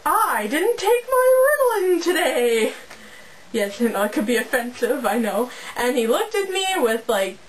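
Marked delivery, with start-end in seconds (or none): 0.0-2.7 high, slow, wailing yell. Retold or imitated. Kid yelling at a passing teacher, wailing high. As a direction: holler it at the new teacher, crying out. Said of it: "I yelled out".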